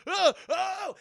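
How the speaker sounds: high, loud yelp of effort; hammed-up shout from the henchmen; mock shout. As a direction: yell it as they grab him. Henchmen grab the cop.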